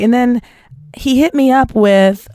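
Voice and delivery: normal talking voice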